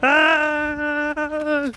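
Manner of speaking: high screaming panic; panicked shout. Pretend. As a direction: shriek it like it's on fire. Mock alarm shout.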